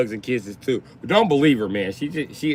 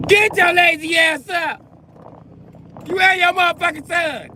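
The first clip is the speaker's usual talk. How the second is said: mock angry mom yelling; 4 dB louder than usual. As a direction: scream it like a furious mother waking everyone. Crazy mom yelling at household.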